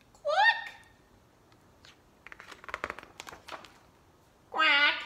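high, loud quack; duck quack. Acted. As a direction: squawk it like a duck. Duck sound in book.

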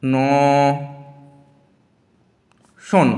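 অ, ন, শন split apart with long pauses; syllables split with long gaps; slow syllable by syllable. Shown as a demonstration. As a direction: break অনশন into অ... ন... শন slowly. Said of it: "আমি ভাঙছি, দেখো".

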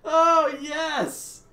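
loud, high burst of laughter then yes; delighted laughing shout. Real, not acted. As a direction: crack up and cheer. Delighted by a clip.

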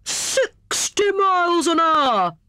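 high, drawn-out marvelling cry; cried out. A narrator's reading. Hare boasts after the sledge topples over, cried out. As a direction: stretch it out in amazed excitement. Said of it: "cried Hare".

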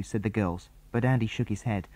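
plain reading voice

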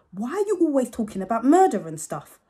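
complain, fed up with her; exasperated complaint. Acted. Kid complaining to Mum.

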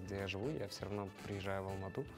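normal talking voice

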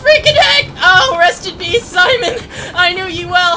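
high, loud, half-laughing panic; dramatic cry to a doomed hero; frantic mock farewell. Real, not acted. Mock farewell while fleeing.

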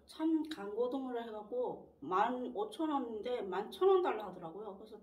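chewing between words; talking with food in mouth; mouth partly full. Real, not acted. Seen 2: Ate just before; lips pursed, cheeks working while talking early on.

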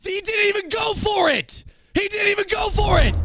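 high, shouted outrage at the screen; furious shout. Real, not acted. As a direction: yell it in real fury at the game. Streamer raging at his player.

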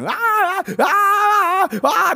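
high drawn-out hammed-up yell; mock yell. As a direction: stretch the cry out loudly. Illustrating the noise.

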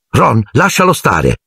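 put-on an irritated girl telling a boy off; angry exclamation. A narrator's reading. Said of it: "esclamò Hermione arrabbiata".

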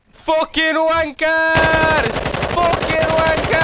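long drawn-out angry yell; angry shouting. Real, not acted. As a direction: bellow in frustration. Angry at losing.

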